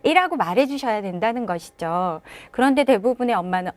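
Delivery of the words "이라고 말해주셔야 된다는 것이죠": usual speaking voice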